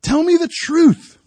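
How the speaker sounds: very high, loud, slow plea "Tell me the truth"; desperate yell. Retold or imitated. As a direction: shout "me" high and drawn out to God. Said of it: "So I yell out".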